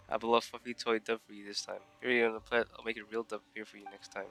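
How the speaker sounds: ordinary delivery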